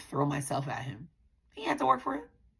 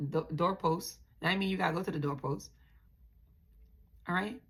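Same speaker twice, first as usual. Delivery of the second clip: ordinary talk, nothing marked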